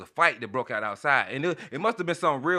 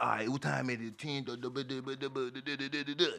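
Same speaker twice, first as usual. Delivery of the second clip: a lightly imitated tipsy guy slurring the time into "duh duh duh"; mock drunken mumble; 9 dB quieter than usual. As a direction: garble the numbers like someone who's lit. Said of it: "That's what it sounded like to me".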